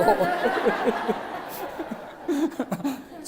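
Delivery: drawn-out amused laughter; long chuckle winding down. Real, not acted. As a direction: let the laugh roll out. Laughs at beauty school joke.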